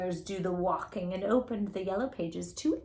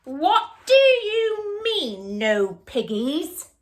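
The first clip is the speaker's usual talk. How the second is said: indignant witch; 4 semitones higher, 6 dB louder than usual. Acted. Witch rebukes the duck.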